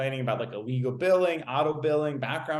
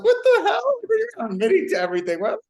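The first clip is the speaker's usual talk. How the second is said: high, loud, rushed mock outrage; indignant outburst; 7 dB louder, much faster than usual. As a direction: retell it as a shrill, incredulous yelp. Retold outraged reply to deans.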